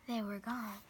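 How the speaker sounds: ordinary delivery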